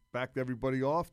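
ordinary delivery

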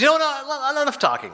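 high, emphatic brush-off; a touch of hammed-up defiance; mock defiant outburst. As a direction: cut him off with a hint of bravado. Midge cutting the host off.

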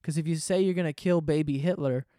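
ordinary talk

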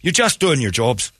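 hearty Irish brogue offering a pint; Irish accent. Pretend. Irish cop vouching for the cuffed man, Irish accent. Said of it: "your Irish accent".

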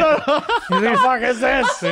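drawn-out loud mock yell through laughter; mocking yell, laughing. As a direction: ham up her outraged scream, slowly and loud. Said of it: "But the way he said".

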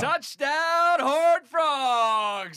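high, loud stadium announcer call. Pretend. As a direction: belt it like a TV play-by-play guy celebrating. Mock play-by-play call of touchdown.

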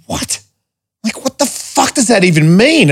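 high, incredulous what the fuck; baffled outrage. Retold or imitated. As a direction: scoff at the boss's request. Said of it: "And I'm like".

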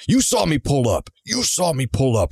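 mock angry accusation. Tow driver insisting to the sister he was seen pulling up, mock indignant. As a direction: act out an agitated man insisting.

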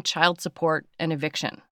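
usual speaking voice

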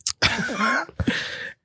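high burst of laughter. Real, not acted. Hearing it's a verb now, laughing.

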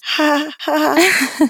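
a light, high little-girl laugh, imitated; mock child laugh. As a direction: giggle like a young daughter. Daughter laughs at mom's trick.

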